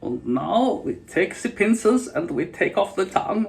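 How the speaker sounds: mock German anatomist describing surgery; Gunther von Hagen. German accent, describing torture to viewers. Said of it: "speak like Gunther, Gunther von Hagen".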